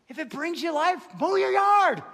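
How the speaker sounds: building to a high, loud holler; emphatic shout. Real, not acted. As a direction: burst out and shout permission to the crowd. Emphatic release after hushed nitpicking question.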